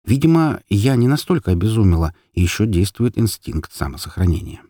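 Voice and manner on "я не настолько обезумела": ordinary delivery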